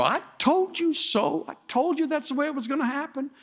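high-pitched imitation of a know-it-all; mocking told-you-so. People who warned you gloat afterwards, high mocking imitation. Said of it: "They warn you, and then it happens".

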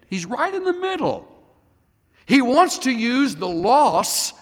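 high, loud and urgent; impassioned preaching. Retold or imitated. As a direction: press the point hard, rising in pitch. Impassioned counsel to grieving woman.

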